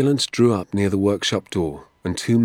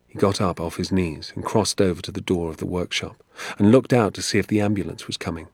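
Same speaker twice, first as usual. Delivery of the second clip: plain reading voice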